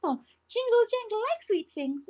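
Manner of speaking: high delighted goblin voice. Acted. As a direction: chirp it up like a happy goblin. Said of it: "her face light up again and says".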